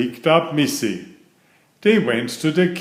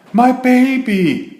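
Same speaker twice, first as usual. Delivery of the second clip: much higher, louder, slow dismayed cry; shocked mother; 13 semitones higher, 8 dB louder, slower than usual. Acted. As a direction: gasp it out as a horrified mum. Mother sees messy baby.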